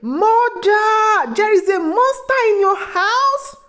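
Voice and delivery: high-pitched, drawn-out cry to mother; narrator's restrained scream, pitched far up; screamed alarm. A narrator's reading. Daughter runs out after finding the mermaid, screaming in alarm. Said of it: "Kemi screamed and ran outside".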